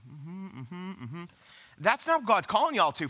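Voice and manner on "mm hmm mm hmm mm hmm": very soft, closed-mouth agreeing hums; passive bystander murmur. Retold or imitated. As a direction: imitate passive listeners humming along in the pew. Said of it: "We sit in church".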